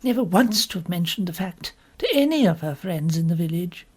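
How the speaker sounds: plain reading voice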